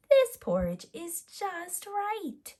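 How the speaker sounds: put-on high, slow, satisfied little-girl voice; little girl delighted with her porridge; pleased Goldilocks. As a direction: savour it like a happy child.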